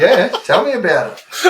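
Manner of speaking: chuckling, tell me about it; laughing agreement. Retold or imitated. Answering the wife's remark about the woman, laughing.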